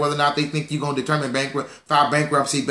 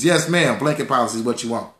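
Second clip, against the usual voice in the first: ordinary delivery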